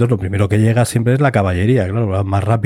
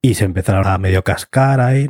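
normal talking voice

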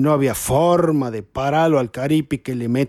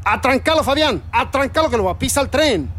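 high, urgent shout in an unfamiliar voice; a mute man suddenly shouting a warning; Adolfo yelling; 8 semitones higher than usual. Retold or imitated. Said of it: "el Adolfo me grita". (Adolfo le grita a Fabián que ataje al caripi, grito agudo y urgente)

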